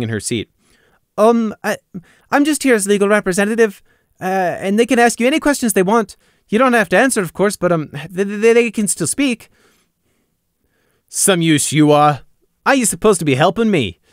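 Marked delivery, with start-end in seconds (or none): 1.2-9.5 much higher, hesitant lawyer voice; a timid legal aide caught in the middle; flustered Minty. A narrator's reading. 11.1-14.0 louder, higher indignant demand. A narrator's reading. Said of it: "Pebble Mill demanded".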